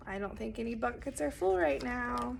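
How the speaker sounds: usual speaking voice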